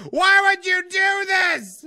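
high, loud, drawn-out wail; stretched, loud protest; mad outburst. Real, not acted. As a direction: cry it out slowly in exasperation. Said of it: "That's how mad I am".

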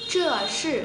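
"Zhe shi" as two long, curled-tongue sounds; long syllables. Shown as a demonstration. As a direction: draw out both "Zhe" and "shi". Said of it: "hai âm dài". (đọc "Zhe shi" thành hai âm dài, cong lưỡi)

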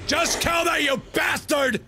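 high, angry shout at the screen; furious yell. Real, not acted. Gamer dying again, furious high yell at the game. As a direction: let the game have it.

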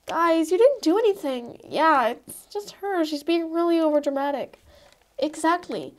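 ordinary talk, nothing marked

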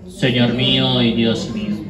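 ordinary talk, nothing marked

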